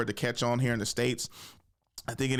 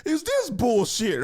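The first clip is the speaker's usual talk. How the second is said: mock outrage; 14 semitones higher, 3 dB louder, slower than usual. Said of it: "I'm like".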